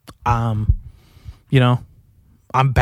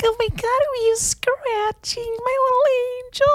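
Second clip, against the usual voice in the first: put-on very high, soft cooing baby talk; gushing pet voice, much higher and softer; baby talk to dog; 3 dB quieter, slower than usual. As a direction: coo at the dog like a tiny baby. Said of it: "I'm just like".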